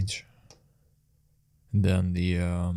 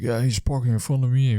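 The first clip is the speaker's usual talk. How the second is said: offended complaint; 4 semitones higher, 4 dB louder than usual. Retold or imitated. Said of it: "he was really offended by it".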